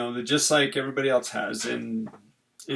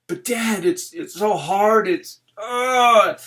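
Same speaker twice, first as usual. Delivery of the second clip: whiny daughter; 6 semitones higher, 3 dB louder, slower than usual. Retold or imitated. Daughter complaining to dad.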